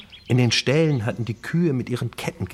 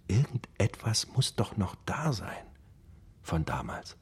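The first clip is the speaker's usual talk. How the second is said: softer, whispered, fading out; whispered longing; 8 dB quieter than usual. Acted. Old man wondering about lost friends, softly whispered. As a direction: breathe it as a lost hope. Wistful memory trailing off.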